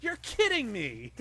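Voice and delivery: high, loud, incredulous outburst; disbelieving, nearly shouting it; shocked disbelief. Retold or imitated. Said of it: "I actually was like".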